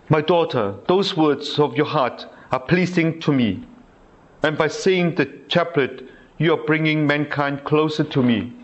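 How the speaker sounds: gentle reading of Jesus' words; devotional reading. Acted. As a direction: read it like a loving reply. Reads Jesus' words from diary.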